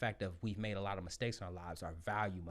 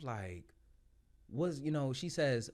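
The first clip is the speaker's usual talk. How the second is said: ordinary talk, nothing marked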